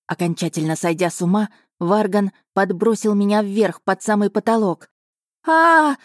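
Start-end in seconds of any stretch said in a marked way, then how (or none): 5.5-6.0 high, loud scream while tossed in the air; sudden startled yell, much higher and louder; shriek of fright. A narrator's reading. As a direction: scream as you fly up. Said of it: "закричала я".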